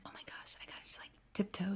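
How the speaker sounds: hushed whisper to avoid waking anyone. Retold or imitated. Woman creeping past sleeping plane passengers, whispering to herself. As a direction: whisper it like a secret self-instruction.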